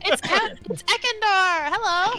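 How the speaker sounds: count. Pretend. Voicing a noble.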